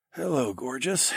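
rusty, croaking voice; croaky voice. A narrator's reading. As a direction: croak it out hoarsely. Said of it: "I croaked out in a rusty voice".

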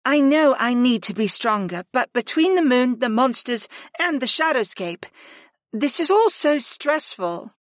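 put-on worried young woman. Nia voiced, stressed.

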